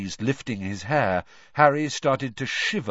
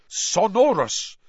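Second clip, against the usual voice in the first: spell; 9 semitones higher, 6 dB louder, slower than usual. A narrator's reading. Spell cast by Bagman.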